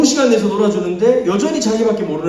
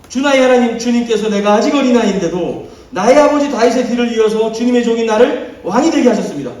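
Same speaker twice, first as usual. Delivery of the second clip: loud, higher impassioned reading; fervent prayer reading; 7 semitones higher, 6 dB louder than usual. Acted. Pastor reading Solomon's prayer to the church, loud and fervent. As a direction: read the prayer with full conviction.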